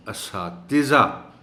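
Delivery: اساتذہ very slow, clear i under ت; correct pronunciation. Shown as a demonstration. Teacher repeats correct اساتذہ slowly. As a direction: drawl اساتذہ as a-saa-ti-za. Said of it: "تے کے نیچے زیر".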